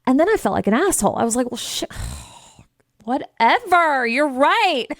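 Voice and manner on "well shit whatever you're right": sighing, slow, reluctant; grudging annoyance. Retold or imitated. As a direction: concede with a grudging sigh. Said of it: "I was so annoyed".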